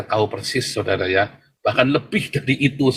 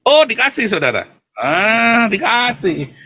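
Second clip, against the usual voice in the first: excited exclamation; 10 semitones higher, slower than usual. Real, not acted. Preacher exclaims God answers.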